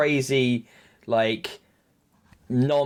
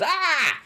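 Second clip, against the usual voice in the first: high startled yelp; scared yelp. Retold or imitated. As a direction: jump with fright. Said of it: "And he goes".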